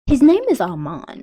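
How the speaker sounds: loud, sharp correction of the name. Acted. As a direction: snap the name back at him, offended. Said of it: "she says it in a nicer way".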